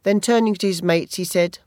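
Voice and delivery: usual speaking voice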